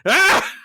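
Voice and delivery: high loud alarmed "Ah"; panicked scream. Retold or imitated. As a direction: cry out in sudden fright. Crew fleeing the Borg cube.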